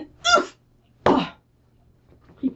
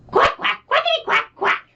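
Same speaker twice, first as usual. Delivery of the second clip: high, squeaky quackity quack; duck quack; 14 semitones higher than usual. Acted. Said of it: "and he said".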